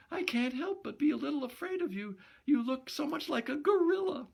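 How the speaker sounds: put-on high, fast young woman's voice; high girlish tone, words rushed. Gloria's voice held in dialogue.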